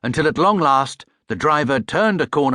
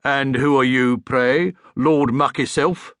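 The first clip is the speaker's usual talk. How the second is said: sneering gatekeeper; 4 semitones higher, 3 dB louder, slower than usual. Acted.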